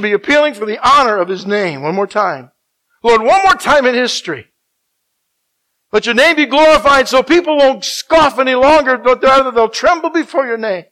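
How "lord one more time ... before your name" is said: loud, high, impassioned plea; full-force preaching. Real, not acted. Preacher pleading with God before the congregation, loud and high. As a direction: cry out for revival.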